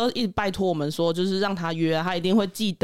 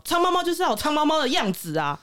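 imitating the customer; 6 semitones higher than usual. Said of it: "他就说".

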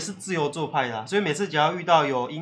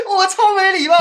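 said through high, loud laughter; laughing; 13 semitones higher, 8 dB louder than usual. Real, not acted. Admitting to friends she was rude, through laughter. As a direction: laugh it out, half cringing.

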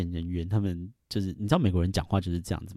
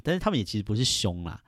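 ordinary delivery

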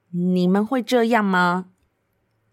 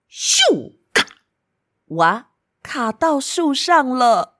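whoosh sound effect; 5 semitones higher, much slower than usual. Acted. Frisbee thrown into tree.

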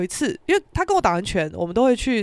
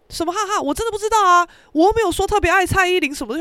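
high-pitched, loud mock defensiveness; imitated protest; 9 semitones higher, 5 dB louder, faster than usual. Friend denying he knows the song, defensive. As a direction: protest your innocence, voice pitched up.

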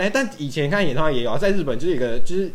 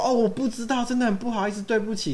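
high, prissy fake apology; mock innocent apology; 11 semitones higher than usual. Rude guy pretending not to know, mock high apology. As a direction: play the innocent. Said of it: "那个男生说".

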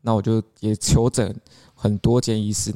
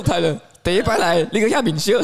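a hint of the Taiwanese-speaking boss, pitched higher; lightly voicing the blunt bald boss; gruff boss; 7 semitones higher than usual. Guest imitating the massage boss greeting him, lightly voiced. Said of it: "他说".

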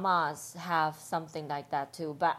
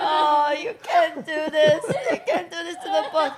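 laughing mock outrage; 7 semitones higher, 5 dB louder than usual.

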